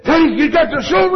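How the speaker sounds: high, loud mock yell at the kid; a father scolding the light-holder; loud, high scolding dad. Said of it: "another word that came out of his mouth".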